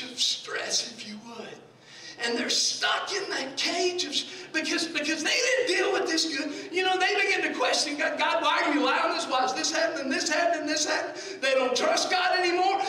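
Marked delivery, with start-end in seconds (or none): 2.2-12.8 driving, forceful sermon voice; impassioned preaching. Real, not acted. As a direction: pile up the questions like a preacher.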